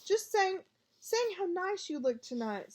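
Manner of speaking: flustered cover. A narrator's reading.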